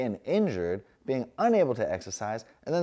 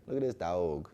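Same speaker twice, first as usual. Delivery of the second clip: usual speaking voice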